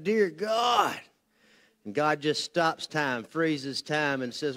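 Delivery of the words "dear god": mock scream. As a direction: cry out in half-hearted mock terror, stretching it. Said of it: "the atheist screams out".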